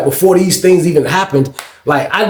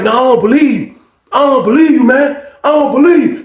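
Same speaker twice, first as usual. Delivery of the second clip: rising angry disbelief; 9 semitones higher than usual. Retold or imitated. Refusing to believe.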